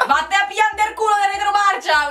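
high-pitched, rattled-off Roman dialect; Burino Roman accent. Shown as a demonstration. Showing a Roman insult to a friend, Burino accent. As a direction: launch into a full Burino insult. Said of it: "an accent that's called Burino".

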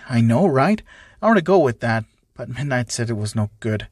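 ordinary delivery, nothing marked

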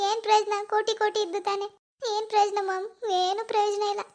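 put-on a grieving young woman close to sobbing; tearful daughter. Daughter grieving her lost baby to her mom, tearful.

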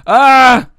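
sudden high scream; scream of alarm. Real, not acted. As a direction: yelp as you get grabbed. Attacked in game.

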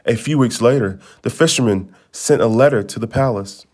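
plain reading voice

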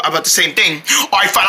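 ordinary talk

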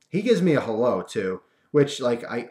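ordinary delivery

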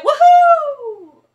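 high, loud "Woohoo" with a falling end; a high, loud cheer of "Woohoo" for the kids to copy. Shown as a demonstration. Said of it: "Can you say".

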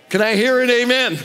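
excited preacher's call; call for amen. Real, not acted. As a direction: rouse the crowd for a response. Calling congregation for response.

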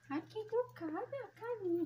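high, soft doting praise; soft baby talk. Acted. Owner praising her dog for sitting, soft high baby talk. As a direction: praise the good girl softly.

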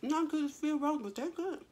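chewing while talking; talking around food; mouth full. Real, not acted. Seen 0: bit sausage at -2; cheeks and jaw still working while talking.

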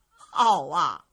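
ordinary delivery, nothing marked

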